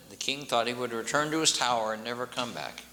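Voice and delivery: ordinary delivery, nothing marked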